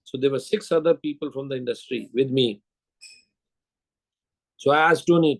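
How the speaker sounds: ordinary talk, nothing marked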